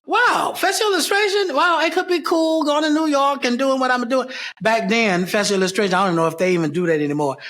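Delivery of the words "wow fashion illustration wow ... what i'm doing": much higher, dreamy excited wonder; retold amazement. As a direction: relive being wowed by a new possibility. Acts out own amazed thought back then.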